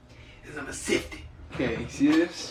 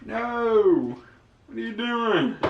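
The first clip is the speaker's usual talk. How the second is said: alarmed no; 9 semitones higher, much slower than usual. Pretend. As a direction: stop them before they pour it out. Stopping viewer from dumping water.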